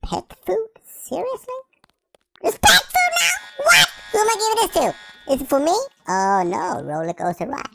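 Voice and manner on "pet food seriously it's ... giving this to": rising high squeak to an outraged shout; indignant disbelief. Acted. Puppy outraged that the prize is pet food, high squealing disbelief. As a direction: build to an outraged cry.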